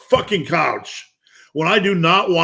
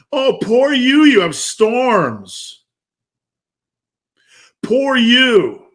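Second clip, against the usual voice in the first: higher, louder sneering pity; mocking inner voice; 8 semitones higher, 4 dB louder, much slower than usual. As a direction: taunt yourself like the lazy voice in your head. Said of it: "a voice in your head telling you to sit down".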